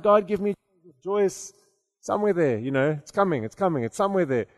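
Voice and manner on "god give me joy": ordinary talk, nothing marked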